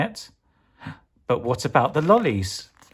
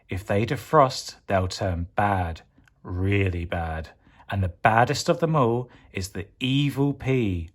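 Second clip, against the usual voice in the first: ordinary delivery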